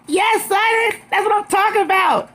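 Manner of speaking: very high-pitched hype squeal; gleeful high-pitched rooting; excited cheering. Real, not acted. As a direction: cheer her on, voice shooting way up. Cheering Sutton on.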